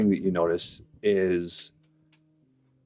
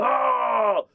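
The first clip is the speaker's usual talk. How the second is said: much higher dopey orc voice; 13 semitones higher, slower than usual. Acted. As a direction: gloat like a pleased simple-minded orc. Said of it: "And he goes".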